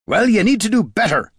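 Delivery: loud, high angry bark; barked order. A narrator's reading. Dwarf demanding better from the drunk ranger, barking. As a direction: bark it at her. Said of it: "barked Gorm".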